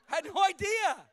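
high, loud exclaim; loud high-pitched outburst; shout. Acted. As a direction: yell it out in disbelief. Shouting his surprise.

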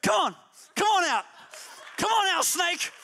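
loud, high taunting call into the hedges; calling the snake out for a fight; mock-brave loud challenge. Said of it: "I just go".

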